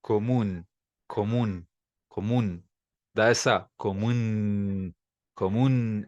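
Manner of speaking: comum ending in a firm n, tongue pressed behind the teeth; slow comum with a crisp final n; Spanish pronunciation. Shown as a demonstration. Teacher showing the Spanish way to say comum. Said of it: "em espanhol".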